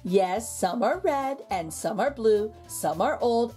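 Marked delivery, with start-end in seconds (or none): none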